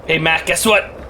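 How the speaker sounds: ordinary delivery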